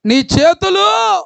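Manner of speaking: high, slow imitated yell; mock cry out. Preacher imitates a beaten wife crying out, mock yell. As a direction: wail it like the one being hit. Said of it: "నేను ఎంత గట్టిగా అంటే".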